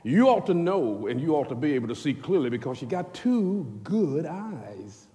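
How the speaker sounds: normal talking voice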